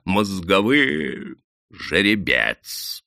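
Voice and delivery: медленно тянет «Мозговым!» с отвращением; процеди «Мозговым!» брезгливо и медленно; проскрипел с отвращением. Acted. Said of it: "проскрипел заросший мужик с отвращением". (мужик с отвращением передразнивает «Мозговым!»)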